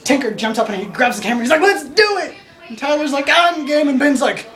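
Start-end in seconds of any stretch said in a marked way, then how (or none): 1.6-2.3 high, excited rallying cry; acted-out gung-ho shout; eager cheer. Tinker eagerly grabs camera. 3.3-3.9 eager agreement. Retold or imitated. Tyler joins in eagerly.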